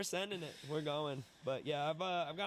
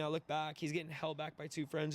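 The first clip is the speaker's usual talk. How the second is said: usual speaking voice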